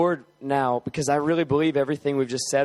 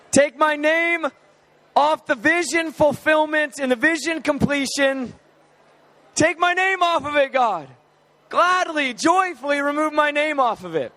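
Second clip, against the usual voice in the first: full-force preaching; 9 semitones higher than usual. Acted. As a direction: preach it out over the room, fervent and high. Leads congregation in fervent prayer.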